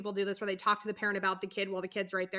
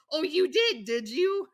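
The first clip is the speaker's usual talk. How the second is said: imitated knowing "oh, really?" voice, starting high; mock surprise, starting high and loud, then easing off; put-on feigned interest; 6 semitones higher, 5 dB louder, slower than usual. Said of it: "so she puts on her".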